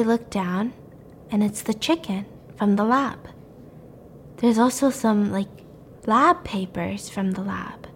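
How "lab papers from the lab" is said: whispered slowly, building suspense; spooky whisper. A narrator's reading. Telling the creepy movie plot to a friend, slow whisper. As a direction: hush it like a secret. Eerie discovery in the woods.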